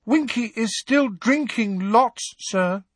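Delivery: very high elf voice, a touch of sorrow; sad elf voice. A narrator's reading. Dobby telling Harry about Winky, high and lightly sad. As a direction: squeak it out with drooping sadness. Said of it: "he said sadly".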